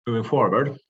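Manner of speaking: usual speaking voice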